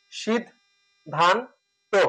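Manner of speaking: সিদ, ধান, তো, each a closed-off beat; separated syllables. Shown as a demonstration. Teacher demonstrating syllables, সিদ and ধান each whole, তো apart. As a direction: give সিদ, ধান, তো one at a time. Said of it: "সিদ এখানে একসাথে উচ্চারণ করি".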